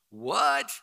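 high-pitched rising disbelief; retold incredulity. Pastor hears choking happened before, mock-incredulous. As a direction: blurt it in mock astonishment.

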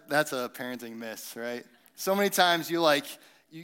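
usual speaking voice

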